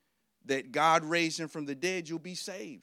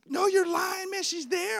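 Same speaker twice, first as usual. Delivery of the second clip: disbelieving roommate; 14 semitones higher, 4 dB louder than usual. Retold or imitated.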